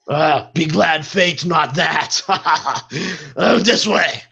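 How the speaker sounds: slow, broken-English brute; lumbering thug guiding the way; dim brute voice. Acted. Brute guard taunts slaves.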